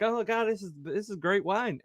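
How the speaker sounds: high-pitched, mock-impressed exclamation; acted amazement. Wedding guests in Cana, mock-amazed praise. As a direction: marvel with the pitch lifted.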